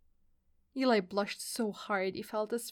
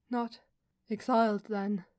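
ordinary delivery

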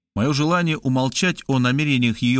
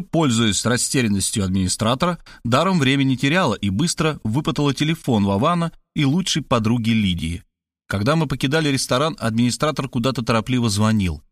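usual speaking voice

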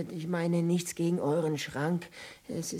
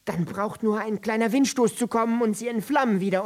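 ordinary delivery

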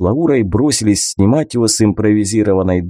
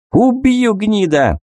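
much higher, enraged yell; furious threat; 11 semitones higher, slower than usual. A narrator's reading. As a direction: scream it like you mean to kill. Bandit threatens to kill.

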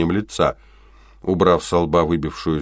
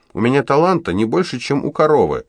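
put-on higher, louder girl's complaint; exasperated outburst; 9 semitones higher, 5 dB louder than usual. A narrator's reading. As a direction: vent her frustration, voice up high. (раздражённо, громче и выше)

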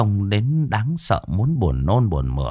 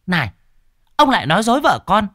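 high, loud sarcastic nagging; mock him, loud and high; sharp-tongued wife; 13 semitones higher, 4 dB louder, faster than usual. Wife scolding husband again.

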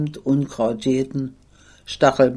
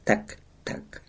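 unvoiced, soft tak tak; whispered gun sounds; 8 dB quieter than usual. A narrator's reading. Narrator imitates distant rifle fire, whispered. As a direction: mimic far-off rifle shots under the breath.

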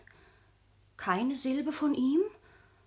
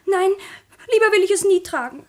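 louder, laughing-edged protest, quicker; loud refusal; 4 semitones higher, 10 dB louder than usual. Acted. Sabine refusing the engagement to her grandmother, loud. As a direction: cry it out, horrified at the idea.

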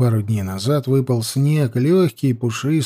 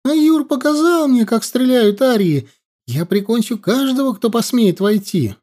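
put-on defiant boy voice; 10 semitones higher than usual. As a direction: threaten like a brave kid. Boy threatens riders with bow.

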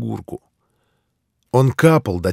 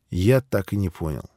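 ordinary delivery, nothing marked

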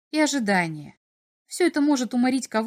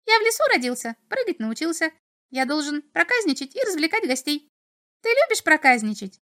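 high, chattering little imp; 7 semitones higher than usual. A narrator's reading. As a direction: rattle on like a cheeky imp. Imp introduces himself.

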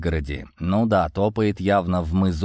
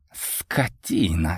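slow, drawn-out insult; heartfelt disgust; 3 semitones lower, much slower than usual. A narrator's reading. Борис condemning Йован before kicking him, heartfelt disgust. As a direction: stretch the insult with feeling. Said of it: "с чувством проговорил Борис".

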